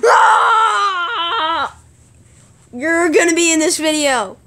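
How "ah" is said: high, loud scream; long high-pitched yell; play scream. Pretend. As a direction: scream out at the mock threat. Screams after mock threat.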